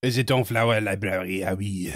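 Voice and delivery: put-on French accent. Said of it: "a poor pastiche of French".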